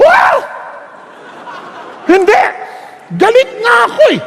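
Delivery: husband refusing to say I love you; childish shout of refusal. Retold or imitated. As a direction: throw a tantrum.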